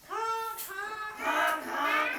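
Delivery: high, loud honking like a goose; sing-song goose honking; goose honks. Shown as a demonstration. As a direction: honk like a goose for the children. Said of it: "What's a goose sound like?".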